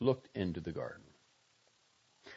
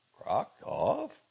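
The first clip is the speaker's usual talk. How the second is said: puzzled question with a rising end, a bit louder; faintly hopeful question; 3 semitones higher, 5 dB louder than usual. A narrator's reading. Doctor hoping it is some new honor, faintly hopeful. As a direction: ask it with a touch of hope. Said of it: "voice expressed a faint hope".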